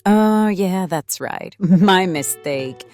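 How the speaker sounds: loud, drawn-out mock agreement; sarcastic concession. Mocking sister's scientist claim.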